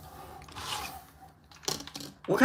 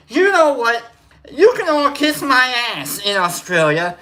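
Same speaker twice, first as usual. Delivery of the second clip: mock rant; 7 semitones higher, 5 dB louder than usual.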